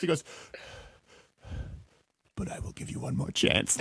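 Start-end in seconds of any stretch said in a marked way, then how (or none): 2.3-3.8 soft, breath-only voice after a rant; suddenly calmed down. Retold or imitated. Host imitating a ranting man who stops to negotiate, hushed. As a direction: drop to a hushed, negotiating near-whisper. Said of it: "But then he stops".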